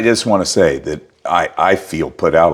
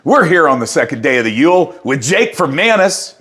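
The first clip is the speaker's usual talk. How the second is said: excited; 9 semitones higher than usual. Acted. Host overplays enthusiastic intro.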